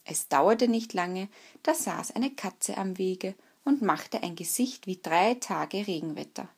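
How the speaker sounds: plain reading voice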